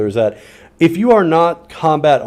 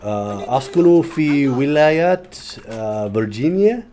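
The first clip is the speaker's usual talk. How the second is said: slow, careful Arabic accent; 4 dB quieter, slower than usual. Shown as a demonstration. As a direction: speak Arabic words in an Arabic accent, slowly. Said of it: "compliment on my Arabic accent".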